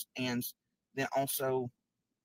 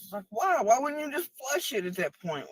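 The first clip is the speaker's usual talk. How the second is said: much higher, louder, slow disbelieving why; exasperated yelling; 12 semitones higher, 8 dB louder, slower than usual. Real, not acted. Ranting about her ex, raised incredulous yell. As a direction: hurl the questions in outrage.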